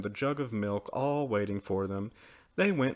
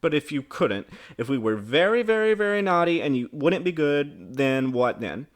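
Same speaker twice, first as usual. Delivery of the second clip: raised, louder pestering question; insistent child; 4 semitones higher, 6 dB louder than usual. A narrator's reading. Children press mother for an answer, insistent. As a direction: push the what-if harder and harder.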